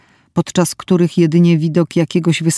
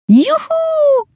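very high, gleeful squeal; joyful child's shout. A narrator's reading. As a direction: shriek it out like a playing little girl. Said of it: "krzyczałam". (radosny, dziecięcy okrzyk)

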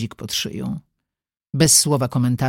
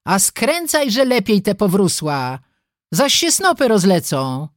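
high-pitched brotherly scolding, mildly raised; scolding call; 6 semitones higher than usual. A narrator's reading. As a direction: call orders to a younger sister. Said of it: "krzyknął na młodszą". (podniesiony, strofujący głos)

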